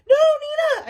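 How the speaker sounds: high loud shout. Acted. Flytrap shouts at Nina, high and loud. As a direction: yell to stop her. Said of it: "I shouted".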